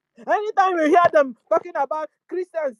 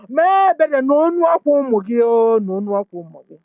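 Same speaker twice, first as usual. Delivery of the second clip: loud prayer for mercy; 3 semitones lower, 9 dB louder than usual. Real, not acted. Activist begging Jehova for mercy, loud prayer. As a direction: cry out the plea, full voice.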